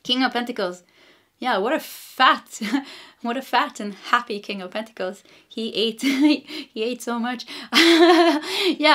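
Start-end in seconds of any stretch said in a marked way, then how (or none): 1.4-8.9 breaking into giggles between phrases; can't stop laughing while talking. Real, not acted. Tarot reader tickled by the fat happy King of Pentacles, laughing. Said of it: "I don't know why I'm laughing".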